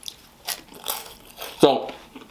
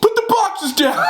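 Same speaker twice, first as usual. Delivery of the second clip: slow, high-pitched mock shout; imitated yelling; slower than usual. Homeowner ordering thief through doorbell, imitated yell. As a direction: holler it as an order, imitating him. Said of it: "I'm sorry I'm yelling".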